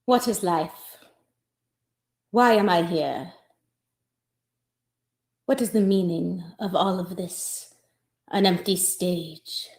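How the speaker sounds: slow, soft, hammed-up dying musings; mock existential monologue. Actress plays a dying soliloquy, slow and soft. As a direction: muse on life in a low hush. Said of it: "I'm so out of breath".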